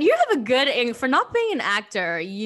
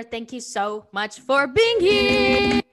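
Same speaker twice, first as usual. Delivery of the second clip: long, slow, louder, higher welcome; drawn-out welcome; 5 semitones higher, 6 dB louder, much slower than usual. Real, not acted. Host welcomes the guest, drawn-out rising thanks. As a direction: stretch the thanks into a big hello.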